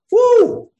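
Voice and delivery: very high, loud whoop; excited woo. Retold or imitated. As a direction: whoop out in amazement. Paul marvelling at his past.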